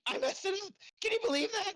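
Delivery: high, exclaimed disbelief; mock exasperation. Real, not acted. Dad flubs the intro live on air, exasperated. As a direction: exclaim it, pitch leaping up.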